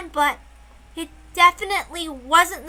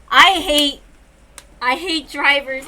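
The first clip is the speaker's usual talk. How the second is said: real annoyance; 9 dB louder, slower than usual. Real, not acted. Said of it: "I'm so fucking pissed".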